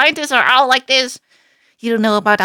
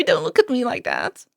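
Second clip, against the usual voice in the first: ordinary talk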